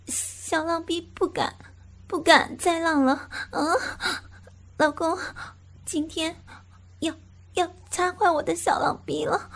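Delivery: high woman's voice pleading, ending in sobs; begging woman. Acted. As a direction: plead with him, near tears. Female character begging.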